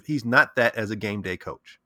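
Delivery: ordinary talk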